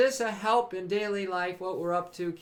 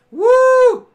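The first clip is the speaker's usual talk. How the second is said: high, loud celebratory woo; loud cheering whoop; 17 dB louder than usual. Real, not acted. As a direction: whoop it out for the good news. Cheering for Marley's news.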